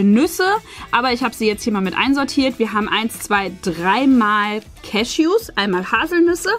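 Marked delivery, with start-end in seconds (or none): none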